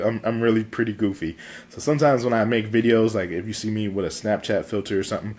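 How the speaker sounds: normal talking voice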